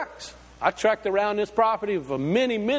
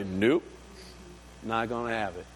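God's answer, much lower and softer; God's reply; 7 semitones lower, 7 dB quieter than usual. Acted. God refuses the preacher's steeple, low and soft.

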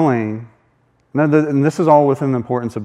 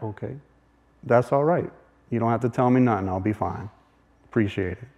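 muttered brush-off; 3 semitones lower, 9 dB quieter than usual. Retold or imitated. Brushing off unhelpful stranger.

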